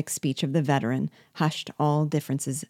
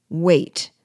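a louder scornful "wait"; exclaimed; 2 semitones higher, 5 dB louder than usual. A narrator's reading. De Rouvray mocking the call to wait, loud exclaim. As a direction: throw the second "wait" back loud. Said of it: "exclaimed Monsieur de Rouvray".

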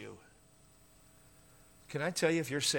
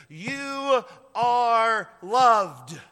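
very slow, louder, higher, stretched; slow climactic declaration; 4 semitones higher, 7 dB louder, much slower than usual. Real, not acted. Pastor to congregation, slow ringing proclamation. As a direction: ring it out to everyone.

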